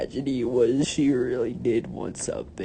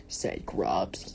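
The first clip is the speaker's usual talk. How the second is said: ordinary delivery, nothing marked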